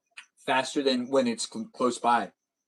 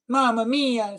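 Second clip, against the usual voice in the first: mock Italian exclamation; 9 semitones higher, 4 dB louder, slower than usual. Mock Italian outburst at error.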